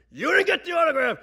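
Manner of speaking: high, full-volume outraged scream. Retold or imitated. Father screaming at Uncle Frank over the autograph, outraged. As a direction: explode like a furious father. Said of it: "they start screaming at each other".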